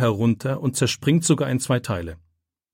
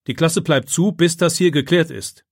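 raised, louder teacher's command; stern order; 6 semitones higher, 4 dB louder than usual. A narrator's reading. Teacher keeps two pupils out of class, stern order. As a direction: bar the way with a firm order.

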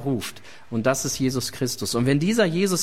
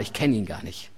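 low, dismissive and softer denial; curt denial; 8 semitones lower, 4 dB quieter than usual. Acted. Peter denying Jesus a second time, low dismissive mutter. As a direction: mutter it away to end the talk.

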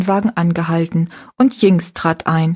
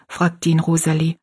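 usual speaking voice

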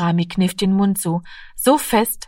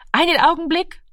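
calling out; 10 semitones higher than usual. A narrator's reading. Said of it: "rief die Mutter".

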